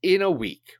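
ordinary talk, nothing marked

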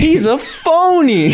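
very high, drawn-out mock accusation; high-pitched pretend outrage; mock exposé. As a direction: squeal it out slowly, half laughing. Acting a listener exposing the accent.

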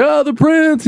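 high, drawn-out hail of a friend; mock-thrilled greeting; excited hailing. Friends hail him by nickname, retold.

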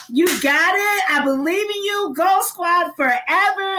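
high, pumped-up pep talk voice; cheering on the orientation crew; hype speech. Retold or imitated. Speaker hyping new students, high pep-talk voice. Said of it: "And I'm telling them, like".

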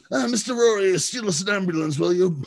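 high-pitched mock Cockney; bad Cockney accent. Asking Mister Rory to steal an ambulance, high fake Cockney. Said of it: "had the shitty Cockney accent".

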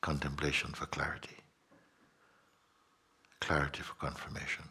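soft, low, near-whispered musing; quiet satsang reflection, sinking to a whisper; hushed contemplation. Acted.

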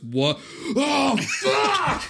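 high yell as he falls; startled shout. Retold or imitated. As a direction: shout in sudden panic. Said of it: "And he goes".

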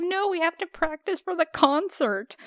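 high, lightly voiced prim teacher. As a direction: brush it off like a fussy teacher. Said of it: "She's just like".

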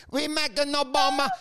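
hammed-up broken English with a Ukrainian accent; Zelensky. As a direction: put on a thick Ukrainian accent.

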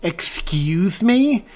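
slow, high, stunned question; can't believe what he just heard; shocked. Acted. Partner hit by the accusation, shocked disbelief. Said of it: "so shocked by the accusation".

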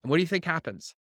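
ordinary talk, nothing marked

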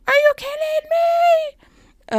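high, slow, drawn-out disbelief; exasperated road rage. Retold or imitated. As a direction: channel an angry dad behind the wheel. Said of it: "like my father would have done".

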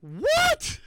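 high-pitched, drawn-out astonishment; stunned disbelief. Real, not acted. Host stunned by the competition result, high incredulous cry. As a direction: stretch it out high in amazement.

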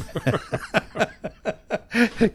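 high breathy laugh. Real, not acted. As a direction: laugh it off, amused. Host laughs at frozen mouth.

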